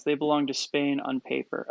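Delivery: ordinary talk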